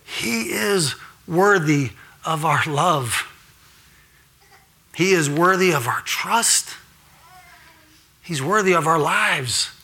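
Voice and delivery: slow, deliberate, leaning on worthy; solemn slow refrain; slow litany. Acted. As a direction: let each worthy land slowly.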